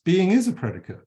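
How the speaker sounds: "is" punched as a contradiction; stress on IS. Shown as a demonstration. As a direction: hit "is" firmly, then fall through "predicate". Contradicts the usual misquote.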